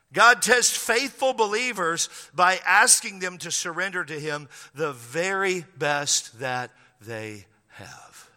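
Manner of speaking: ordinary talk, nothing marked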